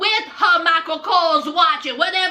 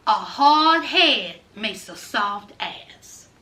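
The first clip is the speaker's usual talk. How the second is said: lower, slow punchline delivery; 9 semitones lower, slower than usual. Shown as a demonstration. Host finishing the old saying for viewers, slow and low. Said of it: "Y'all know that saying?".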